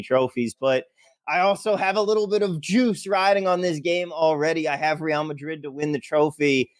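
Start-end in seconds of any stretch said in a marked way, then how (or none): none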